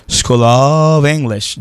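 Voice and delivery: "school of English" très lent, "of" avec V; détacher "school of English" lentement. Shown as a demonstration. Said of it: "je vais dire". ("of" prononcé "ov", lent)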